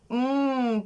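long hummed "mmm"; sound demonstration. Shown as a demonstration. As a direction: hum it out for pupils to copy. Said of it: "Повторіть". (протяжне мугикання «м»)

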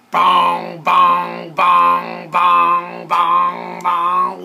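loud, even booming bongs on one note; imitated stomping booms. A narrator's reading. Reader sounds out the dinosaur setting off, booming imitation. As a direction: boom out each heavy step in steady rhythm.

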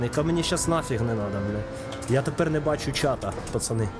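usual speaking voice

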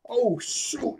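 high, drawn-out mock alarm; startled yelp. Nami seeing the ship appear, high startled squeal.

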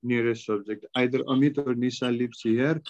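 ordinary delivery

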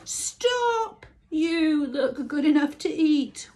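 high, loud whinnying call; whickering horse. A narrator's reading. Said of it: "whickered the horse".

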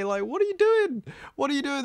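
high, incredulous what are you doing; baffled, exasperated question; exasperated disbelief. Real, not acted. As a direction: pitch it up in disbelief. Exasperated reaction.

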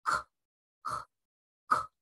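short separate bursts of "クッ"; crisp isolated K releases, "クッ"; K from deep throat. Shown as a demonstration. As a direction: pop "クッ" from the back of the throat. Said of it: "喉の奥の方をね、意識して".

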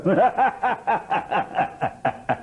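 high mocking laugh; scornful laugh. Shown as a demonstration. Mimic as Chanakya, high mocking laugh. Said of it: "SV Rangarao చాణక్యుడు".

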